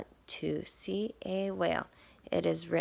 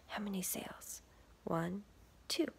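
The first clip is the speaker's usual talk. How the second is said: whisper; 8 dB quieter than usual. Real, not acted. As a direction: whisper it like a secret. Quizzing kids about picture.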